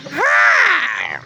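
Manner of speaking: high bright mock greeting; high-pitched imitation of the gas attendant's hello; imitating attendant. Gas attendant greeting him at the pump, high mock voice.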